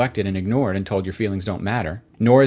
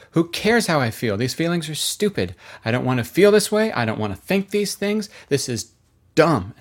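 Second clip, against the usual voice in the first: higher-pitched, retold exasperation; exasperated outburst; 5 semitones higher than usual. Wife venting to her husband about unwanted feelings, mock-exasperated. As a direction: act out an upset wife venting.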